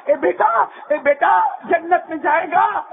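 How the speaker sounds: high, ringing sermon delivery; full-force preaching. Acted. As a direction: preach it out to the whole hall.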